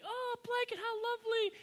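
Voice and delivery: very high, soft falsetto princess; dainty British royal cooing politely in a hammed-up falsetto; Kate Middleton. Royal mother politely receiving a stranger's baby gift, mock high falsetto. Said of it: "Kate Middleton's like".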